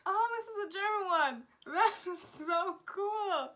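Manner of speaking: high, loud squeal of delight; delighted excitement. Real, not acted. Vlogger spots a German word, thrilled outburst.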